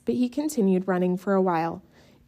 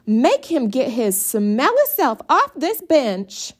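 higher, louder whining demand; whiny yell; 5 semitones higher, 5 dB louder than usual. A narrator's reading. Brenda yelling to Mama, high and whiny. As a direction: wail it at Mama.

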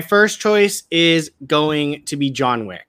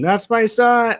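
higher-pitched mock cry of a dad; screaming dad; 9 semitones higher than usual. Quoting a film dad's scream over his son, imitated cry. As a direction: imitate a dad crying out, without full volume. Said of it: "where his dad is screaming".

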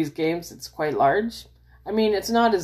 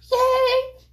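put-on very high squealed yay like a little kid; high delighted child's cheer; kid at Christmas; 4 dB louder than usual. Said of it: "you're a little kid and you're at Christmas".

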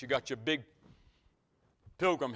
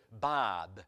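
low, imitated Massachusetts accent; 5 semitones lower than usual. Local man gives the preacher his name, Massachusetts accent. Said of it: "they do have a different accent".

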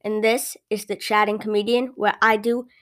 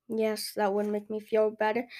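subdued customer; 7 dB quieter than usual. Acted. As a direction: say it quietly, a little worn out. Customer accepts comfort.

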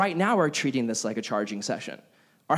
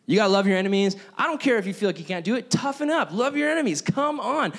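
pitched way up, bossy and pushy; mock drill sergeant Jesus; 4 semitones higher than usual. Preacher mocking a harsh demanding Jesus, high bossy voice. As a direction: bark orders like a tough coach. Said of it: "He doesn't say".

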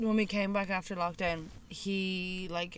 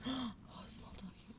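gasped whisper of delight; excited whisper; 4 semitones higher, 12 dB quieter than usual. Real, not acted. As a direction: whisper in awe at the dog. Spots a small dog.

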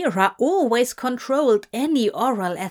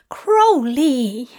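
loud, higher whining cry of the name; mortified whine; 4 semitones higher, 6 dB louder than usual. A narrator's reading. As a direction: protest his name, embarrassed. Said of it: "Zira whined".

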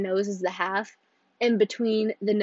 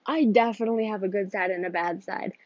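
ordinary talk, nothing marked

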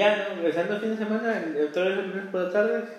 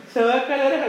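high, louder imitated whine; mock whining; 7 semitones higher, 5 dB louder, much faster than usual. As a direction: retell her panicky cry, hammed up. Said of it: "me dijo, me dijo". (queja chillona imitada, más aguda)

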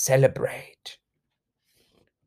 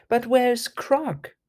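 usual speaking voice

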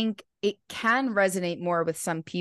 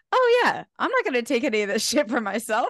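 relieved and upbeat; 7 semitones higher than usual. Retold or imitated. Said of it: "so grateful to be like".

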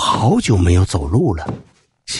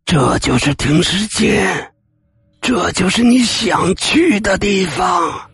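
menacing ghost; 12 semitones higher, slower than usual. Acted. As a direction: boom it out like a specter. Ghost's final booming line.

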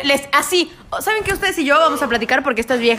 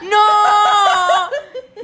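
laughing shout; 8 semitones higher, 4 dB louder than usual. Real, not acted. Reacting to chibros, laughing shout.

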